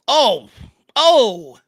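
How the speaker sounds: loud, high startled "oh"; excited gasp. Real, not acted. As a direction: react to a near miss. Near miss, reacting live.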